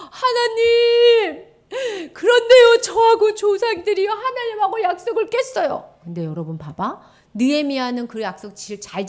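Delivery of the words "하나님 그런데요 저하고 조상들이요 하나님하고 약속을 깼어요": high, loud crying plea, very slow at first; tearful prayer. Acted. Teacher voices Nehemiah confessing to God in a loud crying plea.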